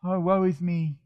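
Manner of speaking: a hammed-up woe-is-me sigh; mock self-pity. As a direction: whine it like a sorry victim, tongue in cheek. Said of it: "Why must I believe his drivel".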